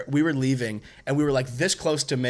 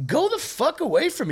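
much higher, exasperated pitch; retold exasperation; 12 semitones higher than usual. Retold angry kitchen confrontation.

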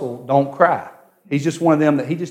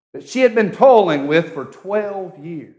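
normal talking voice

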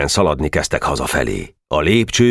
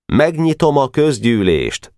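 slow, higher, mock-grave opening; solemn announcement; 9 semitones higher than usual. Weiss opening the club meeting, very seriously. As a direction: open the meeting with great ceremony. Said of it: "mondta rettentő komolyan".

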